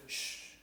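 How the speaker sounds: "sh" as a long soft hiss, no vowel; SH sound. Shown as a demonstration. Teacher showing how French CH sounds, long "sh". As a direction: hush out a bare "sh". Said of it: "Siempre vamos a tener el".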